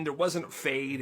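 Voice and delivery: ordinary talk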